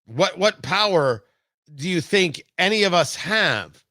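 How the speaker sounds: normal talking voice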